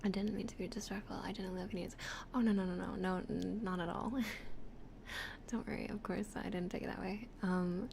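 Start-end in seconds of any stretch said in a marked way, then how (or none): none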